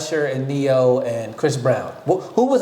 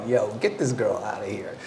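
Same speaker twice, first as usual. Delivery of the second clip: low, softer mock aside to security; muttered aside; 7 semitones lower, 6 dB quieter than usual. [name] quietly telling his crew to remove the girl, low aside. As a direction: mutter it to your guard on the side. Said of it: "And he's like".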